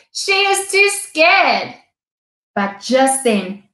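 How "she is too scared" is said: higher, slow mocking sneer; scornful snort. A narrator's reading. Rhino scoffing at absent Tickbird, slow and sneering. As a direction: drag it out with contempt. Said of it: "Rhino snorted".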